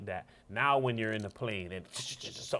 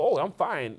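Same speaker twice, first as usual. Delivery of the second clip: mock dismissive; 8 semitones higher, 10 dB louder than usual. People claiming to be fine.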